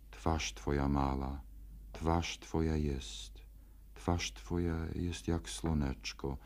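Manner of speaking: soft unvoiced whisper; whispered line. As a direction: breathe it like a secret to a lover. Tender line of a love poem.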